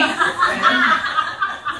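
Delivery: a short quiet chuckle at his own joke; soft laugh. Real, not acted. Preacher chuckling at the Israelites as teenagers, soft laugh. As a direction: breathe out a small laugh.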